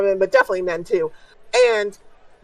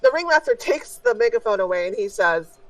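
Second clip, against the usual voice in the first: normal talking voice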